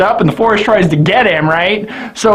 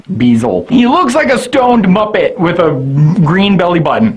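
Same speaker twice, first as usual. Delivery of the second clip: usual speaking voice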